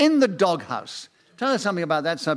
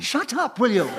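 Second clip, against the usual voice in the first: high, giggly mock rebuke; laughing shut up; 5 semitones higher than usual. Real, not acted. Host laughing at a panellist's tan joke, giggly mock rebuke. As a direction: tell him off through laughter.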